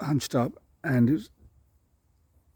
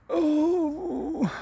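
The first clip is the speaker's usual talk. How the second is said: surprised oh. Retold or imitated. Walking in on parents spooning.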